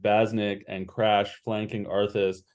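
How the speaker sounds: usual speaking voice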